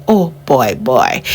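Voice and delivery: normal talking voice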